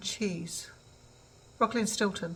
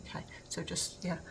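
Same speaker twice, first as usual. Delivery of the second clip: soft whispered plea; 7 dB quieter than usual. Real, not acted. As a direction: breathe it as a gentle whisper. Asks viewers for patience, whispering.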